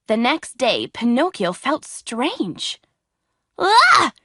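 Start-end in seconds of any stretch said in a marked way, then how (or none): 3.6-4.1 high, loud frightened cry; scared cry. A narrator's reading. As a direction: shriek in sudden fright. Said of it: "he cried".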